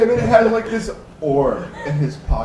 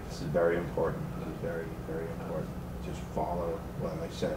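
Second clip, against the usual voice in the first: low, hushed instructions; hushed urgency; 7 semitones lower, 12 dB quieter, much faster than usual. Acted. Timmit telling the guys his plan while they hide, hushed. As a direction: drop to a soft, confidential undertone. Timmit secretly briefs the hiding group.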